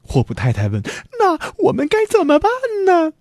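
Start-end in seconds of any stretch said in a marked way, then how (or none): 1.2-3.1 very high, hurried wife voice; helpless, high-pitched fretting; anxious question. A narrator's reading. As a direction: ask in a quick, high panic. Frightened wife asks what to do.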